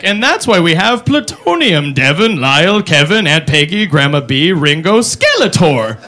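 grandpa lecture. Retold or imitated. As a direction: list names like an old man. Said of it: "Lessons from him were like".

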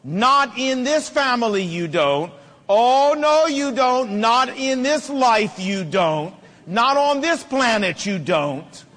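emphatic, slow acted scolding; in-your-face father. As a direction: lay down the law like a dad confronting his child. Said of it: "got in their face and said".

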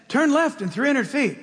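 lightly voiced GPS lady giving directions; mild mock navigation voice; imitating the GPS girl. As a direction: mimic the sat-nav woman's voice. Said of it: "it's in a girl's voice".

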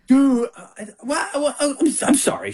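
high, stammering exasperation; retold exasperated protest. Husband retelling his defence to his wife, high sputtering protest.

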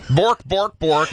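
sing-song mock Swedish gibberish voice; Swedish Chef. Host bluffing through Norwegian names, mock Swedish cook voice. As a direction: bork it out in a comic fake Swedish accent. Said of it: "just gonna Swedish chef my way".

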